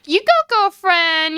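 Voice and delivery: very high, playful mimicry; cheering girlfriend. [name] quoting his girlfriend on the costume, high cheering voice. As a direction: imitate a gushing, supportive girlfriend. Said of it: "she is all like".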